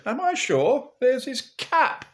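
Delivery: high, loud Northern father; slow, high-pitched comic indignation; indignant Pa. Acted. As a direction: protest loudly as if doubted. Pa indignantly proves son was eaten.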